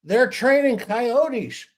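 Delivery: put-on yelling drunk. Drunk yelling to his buddies about coyotes, mock high yell. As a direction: ham up the drunk calling out. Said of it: "he yells to his buddies".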